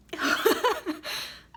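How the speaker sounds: breathy laugh through tears; mother laughing through tears at a positive test; teary laugh. Real, not acted. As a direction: laugh out, half crying. Tearful news of positive test.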